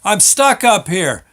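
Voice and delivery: shouting down the elevator shaft; hollering down. Retold or imitated. Man stuck in elevator hollers down to those below, loud. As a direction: yell it down from high up. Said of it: "I holler down".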